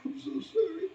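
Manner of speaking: high, softening apology; mother apologizing to her son for their world; sorrowful mother. Retold or imitated. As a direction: apologize with a breaking heart. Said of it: "She said".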